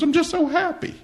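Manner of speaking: a squeaky mock of his tearful wife; wife's high teary voice. Husband imitates his crying wife in a high squeak. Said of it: "She goes".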